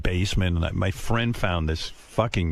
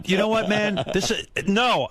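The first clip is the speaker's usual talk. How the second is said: higher, mock indignant outburst; retold anger; 9 semitones higher than usual. Son interrupts father's sex talk, mock angry. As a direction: cut in as an angry teen, lightly acted. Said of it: "I got angry".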